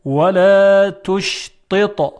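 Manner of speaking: measured teaching model of ولا تشطط; emphatic ta example. Shown as a demonstration. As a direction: recite ولا تشطط with long ولا. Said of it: "و آخرین مثال".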